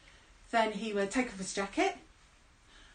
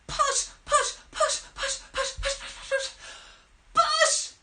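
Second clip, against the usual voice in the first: straining effort; 13 semitones higher, slower than usual. Acted. Straining to push the turtle.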